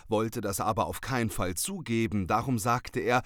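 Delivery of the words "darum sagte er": ordinary delivery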